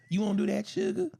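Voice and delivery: mock Southern accent, sugar pitched high. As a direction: tease in a put-on Southern accent. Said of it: "that Southern accent".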